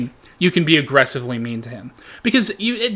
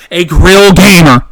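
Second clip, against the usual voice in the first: loud drawn-out yell; 4 semitones higher, 13 dB louder, slower than usual. Real, not acted. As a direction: belt out grill gamer, slowed and much louder. Repeating the phrase with rising gusto.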